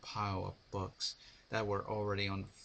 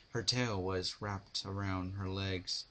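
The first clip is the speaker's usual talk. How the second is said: usual speaking voice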